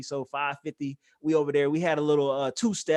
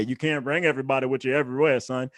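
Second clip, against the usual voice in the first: lower, quicker fatherly lecture voice; imitating daddy; 5 semitones lower than usual. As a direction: drop into his dad's lecturing tone, brisk. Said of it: "I sound like my daddy every day".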